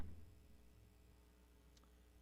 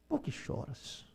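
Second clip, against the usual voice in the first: Por que choras said barely audible, slow and hushed; near-silent echo; 10 dB quieter, slower than usual. Shown as a demonstration. Pastor softly echoing Jesus asking the weeping woman, hushed. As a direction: let Por que choras fade almost to nothing. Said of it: "repeated as a soft echo".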